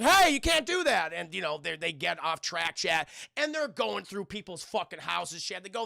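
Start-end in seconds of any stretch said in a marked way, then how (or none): 0.0-1.1 high, loud mock protest; protesting townspeople. Townspeople yelling at kids on the slide, mock indignant. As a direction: call out in indignant alarm. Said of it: "people are like".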